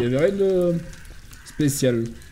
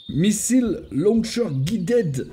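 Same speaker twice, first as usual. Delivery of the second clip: slow, higher, hammed-up English accent; mock English accent; 6 semitones higher, much slower than usual. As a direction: show off a French speaker's "magnificent" English accent. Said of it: "Avec mon magnifique accent anglais".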